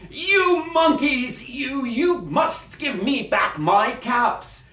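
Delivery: high, slow, loud outburst; very angry shout. Acted. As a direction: shout each word at the monkeys, drawn out. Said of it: "stamped both his feet and shouted".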